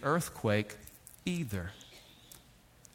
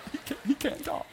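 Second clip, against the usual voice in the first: soft, laughing, breaking into a high voice; mock gleeful laughing; 9 semitones higher, 12 dB quieter, faster than usual. Family celebrating dad's lost voice, laughing mockingly. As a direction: imitate someone giggling at another's misfortune.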